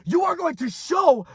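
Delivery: high-pitched, full-throated rallying cry; impassioned motivational shouting. Real, not acted. Coach telling listeners to use their anger, impassioned shouting.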